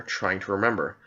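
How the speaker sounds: ordinary delivery, nothing marked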